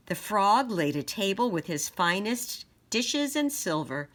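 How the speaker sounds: put-on bright, loud read-aloud to children; lively picture-book narration; storybook reading. A narrator's reading. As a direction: read it out big for the little ones.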